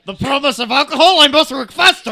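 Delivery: high, loud frantic yell; frantic shout. Acted. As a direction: yell it like a mad tinkerer. Acted character quote shouted.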